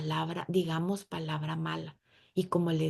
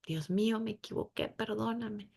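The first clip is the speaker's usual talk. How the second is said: hushed, much softer plea; soft plea for forgiveness; 2 semitones higher, 6 dB quieter than usual. Pretend. Woman asking God's forgiveness, hushed and pleading. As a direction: murmur it like a repentant prayer. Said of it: "vamos con Dios llorando".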